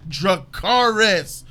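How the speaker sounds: loud, drawn-out battle order; acted rallying command. Missandei urging Khaleesi to burn the city, slow loud command.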